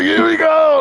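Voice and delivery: high, loud fan cheer; high, loud cheer of a fan freaking out; excited shout. Retold or imitated. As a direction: shout it like the season is won. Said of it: "freaking the fuck out".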